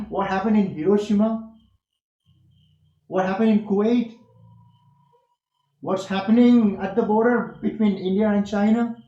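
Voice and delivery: demonstrative questioning, pitch up through "Kuwait"; rising question tone. Shown as a demonstration. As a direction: send the sound up on each question and pause for an answer. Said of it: "The sound should go up".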